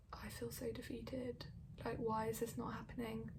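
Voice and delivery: a hint of tearful defeat; defeated whisper. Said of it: "crying and crying".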